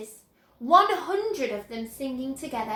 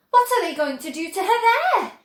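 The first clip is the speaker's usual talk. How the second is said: fast, louder worried question; anxious; 6 semitones higher, 7 dB louder, faster than usual. A narrator's reading. Mother begging Wonka to explain, fast and louder. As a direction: rush it out in fear.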